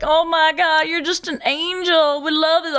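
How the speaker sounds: high-pitched, effusive Southern drawl; Southern accent. Retold or imitated. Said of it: "they've got that amazing Southern accent".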